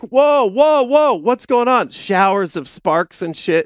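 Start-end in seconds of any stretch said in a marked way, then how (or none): 0.0-1.9 alarmed hitchhiker. Retold or imitated. Retelling the hitchhiker's alarm at the scraping car, mock startled. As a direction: play the passenger spooked by sparks. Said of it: "And the guy's like".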